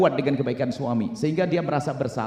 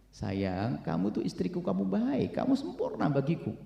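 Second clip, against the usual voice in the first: tender husband; 8 dB quieter, slower than usual. Acted. Husband reassuring his wife.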